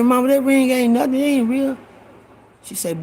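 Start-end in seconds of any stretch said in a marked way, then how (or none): 0.0-1.8 high mock-innocent excuse. Boy tells mama the ring is fake, high and pleading. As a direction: talk her out of it, pitched up. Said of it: "I said".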